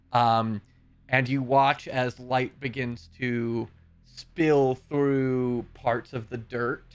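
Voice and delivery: normal talking voice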